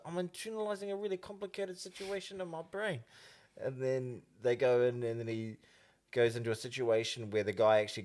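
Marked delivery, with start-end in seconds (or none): 0.1-3.0 put-on high, softer rural Māori accent. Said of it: "the way I was talking right then".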